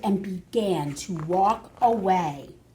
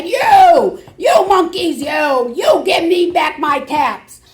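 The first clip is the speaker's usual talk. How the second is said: much higher, louder furious yell; angry shout; 6 semitones higher, 8 dB louder than usual. Acted. Peddler stamping his foot, yelling at the monkeys. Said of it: "he felt quite angry".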